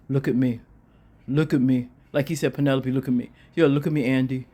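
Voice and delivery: low voice lightly imitating a manipulative man; mock persuader. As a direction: drop the pitch and mimic his insistent appeal.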